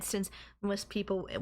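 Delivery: normal talking voice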